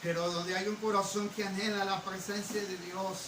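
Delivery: ordinary delivery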